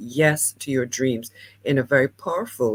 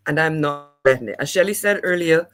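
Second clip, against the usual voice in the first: ordinary talk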